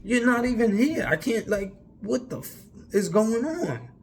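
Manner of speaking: pitched well up, incredulous and frustrated; high, disbelieving outburst; exasperated rant. Retold or imitated. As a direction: demand answers from an absent God. Ranting at God after the crash.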